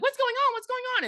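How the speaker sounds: very high, repeated frantic question; mock alarmed mom. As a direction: imitate a mother panicking down the phone. Voicing alarmed mom on phone.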